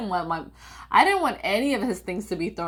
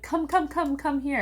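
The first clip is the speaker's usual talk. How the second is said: eager call; 8 semitones higher than usual. Retold or imitated. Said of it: "My dad comes home and he's like".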